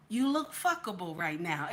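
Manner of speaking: partner's hushed compliment, retold; imitating his low murmur. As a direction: quote him low and softly. Retelling her man's compliment.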